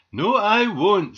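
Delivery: loud, high, drawn-out retort; defiant refusal. A narrator's reading. Squirrel bouncing on the aerial, defiant refusal. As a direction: refuse cheekily at full voice.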